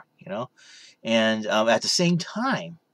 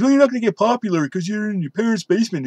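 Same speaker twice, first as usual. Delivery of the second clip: higher, quick mock sneer; mocking critics; 8 semitones higher, faster than usual. Imitating YouTube critics mocking his basement, sneering. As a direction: rattle off the critic's jab. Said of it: "then can criticize my YouTube".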